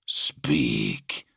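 low, monstrous master's voice; deep, imperious horned creature; menacing monster lord. A narrator's reading. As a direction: question a servant in a deep creature voice. The Exalted commands the officer.

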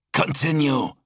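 high-pitched order; commanding. Acted. Zal tells his brother to resume, commanding.

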